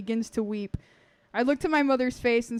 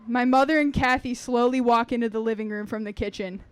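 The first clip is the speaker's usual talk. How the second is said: usual speaking voice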